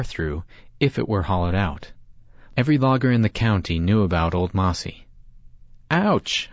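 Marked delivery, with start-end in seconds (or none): 5.9-6.5 sharp high yelp of pain; yelling ouch. A narrator's reading. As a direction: cry out suddenly in pain. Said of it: "I yelled".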